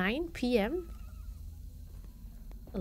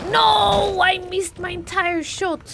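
frustrated cry; 5 semitones higher, 7 dB louder than usual. Real, not acted. Missed shot in game.

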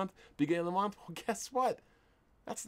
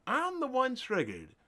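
higher, mocking sarcastic repeat; 9 semitones higher than usual. Real, not acted. Mocking critics who call him the triggered one, sarcastic. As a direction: throw "triggered" back at them with scorn.

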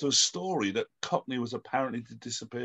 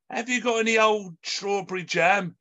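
lightly voiced lady shopper, pitched higher; lady customer; 6 semitones higher than usual. Lady asking a shopkeeper for jam, lightly acted, higher-pitched. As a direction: ask it as a customer, raised pitch. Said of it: "a lady in a shop".

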